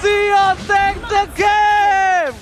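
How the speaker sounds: slow, stretched victory shout; fighting game announcer. Real, not acted. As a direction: announce the winner to the crowd. Caster calls game winner.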